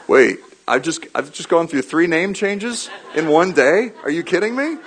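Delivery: high, laughing mock outrage at the renaming; mock indignant disbelief. Peter protests to Jesus, laughing, in mock disbelief. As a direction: play Peter baffled and half laughing.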